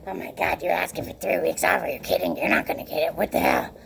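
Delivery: low, soft scoffing coworker voice; a deep, hushed impression of a doubter; mocking coworker. Coworker scoffing at her request, low mock voice.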